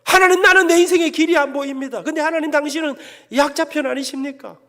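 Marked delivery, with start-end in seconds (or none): 0.0-2.0 loud, high begging prayer; pleading prayer. Retold or imitated. Poor student begging God at dawn prayer, loud pleading. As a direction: beg God for a push upward. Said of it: "제가 못되게 기도했습니다".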